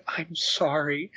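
soft, slow, mumbled under the breath; imitating a sheepish muttered sorry; muttered apology. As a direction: mutter it like a mortified girl. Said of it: "she muttered".

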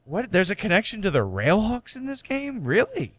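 playing up the shock of a surprise connection; retold disbelief. Retelling his World Cup reaction with incredulous rising pitch. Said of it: "It's just like".

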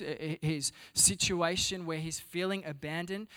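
ordinary talk, nothing marked

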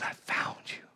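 soft breathless whisper of relief; tender whisper. Acted. God to his found child, tender whisper. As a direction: whisper it as if holding the child close.

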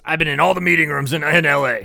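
mock a man holding forth; retold quote. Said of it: "He goes".

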